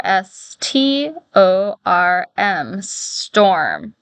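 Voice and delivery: each letter drawn out slow and separate; sounding out slowly. Shown as a demonstration. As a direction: stretch it out sound by sound, like for a child. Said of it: "let's put that together".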